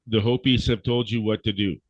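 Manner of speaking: ordinary talk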